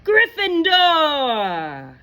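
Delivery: drawn-out high, loud announcement; hum, then cry out the house slowly; shouting hat. Acted. The Sorting Hat announces Hannah's house, shouting slowly. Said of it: "shouted the hat".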